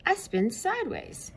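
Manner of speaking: high playful voice; high silly planet voice; Uranus character. Acted. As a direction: play quirky Uranus.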